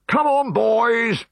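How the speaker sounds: high, slow, loud rallying yell; grim yell. Acted. Decurion leaping out at his men, a grim loud yell. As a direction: yell the charge out of hiding. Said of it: "yelled the Decurion grimly".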